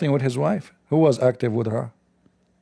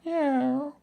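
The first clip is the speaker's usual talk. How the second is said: "Meow" mewed much higher, like the stray cat; cat's meow; 14 semitones higher, 5 dB quieter than usual. Retold or imitated. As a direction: squeak "Meow" high and thin like a cat. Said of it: "She say".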